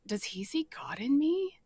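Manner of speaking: hushed inner wonder; whisper. Retold or imitated. Whispering to herself about Bill. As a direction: breathe it like a secret thought.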